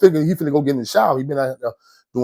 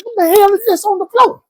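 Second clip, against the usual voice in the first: much higher, shrill-pitched outburst of shock; shocked cry; 4 dB louder than usual. Retold or imitated. Slipping on the oiled floor, a high shocked outcry. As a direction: yelp it as your feet go out.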